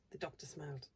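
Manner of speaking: ordinary delivery, nothing marked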